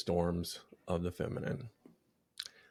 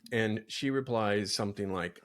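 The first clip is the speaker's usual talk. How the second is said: normal talking voice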